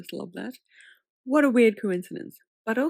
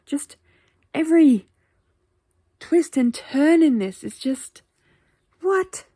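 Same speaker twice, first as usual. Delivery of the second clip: high, loud, drawn-out incredulity; reeling from every twist, loud and high; stunned disbelief; 6 semitones higher, 9 dB louder than usual. Real, not acted.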